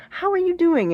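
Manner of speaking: high-pitched acted greeting; questioner. Acts out someone asking a question.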